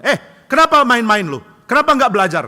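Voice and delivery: father yelling at his son for playing; angry father. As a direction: tell him off properly.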